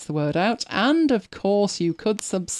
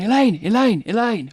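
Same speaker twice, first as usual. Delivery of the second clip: priest calling her name; 4 semitones higher than usual. Retold or imitated. Priest calling after her in church.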